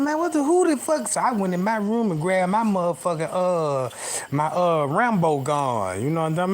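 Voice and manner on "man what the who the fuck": higher, alarmed who's-there; startled call. Retold or imitated. Hearing water gush in his bathroom, alarmed higher call. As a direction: jump at a sound behind the door. Hears water behind locked door.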